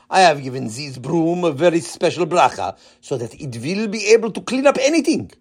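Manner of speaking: put-on thick Israeli accent. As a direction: explain proudly in an Israeli accent. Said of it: "in his heavy Israeli accent".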